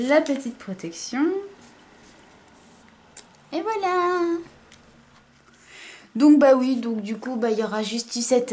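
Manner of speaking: normal talking voice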